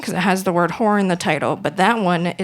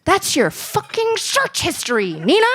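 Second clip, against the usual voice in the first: high mock-furious mom voice; angry mom; 10 semitones higher than usual. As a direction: imitate a furious little mother. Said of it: "And she goes".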